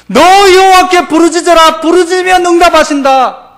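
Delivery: thundering sermon voice; full-force preaching. Acted. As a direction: proclaim it like a prophet.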